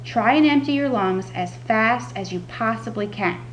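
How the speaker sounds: ordinary delivery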